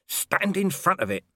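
slow, high, strained hiss; urgent stage hiss. A narrator's reading. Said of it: "Vimes hissed".

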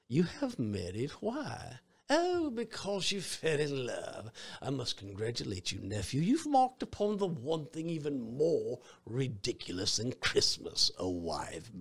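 high, sneering old miser mocking the marriage; scornful Scrooge. Preacher as Scrooge scoffs at his married nephew, high sneer.

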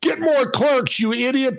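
high-pitched mock shout; imitated angry yell. Lady yelling at the grocery clerk, imitated angry shout. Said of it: "this lady yells out".

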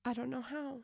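broken woman pleading. Acted. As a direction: plead with God like a lost child, holding back tears. Broken woman answers God.

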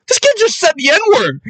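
high-pitched imitated outcry; mock yelling. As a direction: report it in a scandalized high yelp. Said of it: "like, yelling and screaming".

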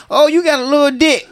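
high, loud, laughing taunt; mock woman's insult. Woman insulting a man, high and laughing. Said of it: "a woman saying".

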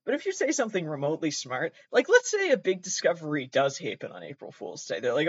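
ordinary talk, nothing marked

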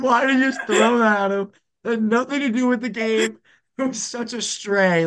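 very high-pitched incredulity; amused disbelief. Retold or imitated. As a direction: retell it in helpless high-voiced astonishment. Laughing disbelief at the insult.

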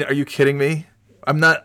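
ordinary talk

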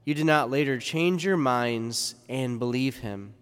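ordinary talk, nothing marked

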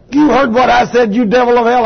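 high, loud demanding challenge; preacher shouting down a demon; loud rebuke. Retold or imitated. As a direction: confront the devil head on. Rebuking the devil.

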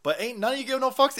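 usual speaking voice

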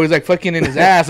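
normal talking voice

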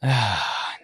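audible breathy sigh before refusing; exasperated sigh. Real, not acted. Friend told he could have housed the dog, sighing. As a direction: let out a sigh of reluctance. Sighs at suggestion of keeping dog.

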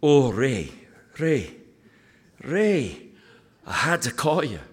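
retold pastor in a lightly done broad Glaswegian accent, eager; phone call from a Scottish friend, half-acted with a chuckle; imitating a Glasgow pastor calling with news, mild Glaswegian lilt; [name]'s Glaswegian accent. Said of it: "And he says".

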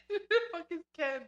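high, laughing through words. Real, not acted. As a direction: crack up mid-question.

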